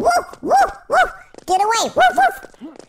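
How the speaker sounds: very high yappy woofs; playful dog barks. Pretend. Player shooing something away in game, high playful barking. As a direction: bark like a small excited dog.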